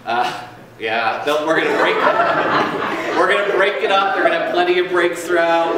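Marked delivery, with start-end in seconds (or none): 1.2-5.8 laughing. Real, not acted. Laughs while announcing breaks.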